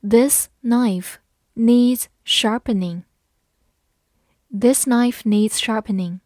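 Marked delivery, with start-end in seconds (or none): 0.0-3.0 slow, a little louder, word by word; slow and clear, each word apart; slow reading. Shown as a demonstration. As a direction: read it slowly for learners. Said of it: "好，慢慢来读。".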